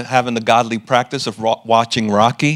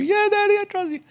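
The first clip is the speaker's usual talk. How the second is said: put-on much higher, small child voice saying yes to daddy; little girl voice; 3 dB quieter than usual. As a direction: play his young daughter, pitched way up. Father voices young daughter.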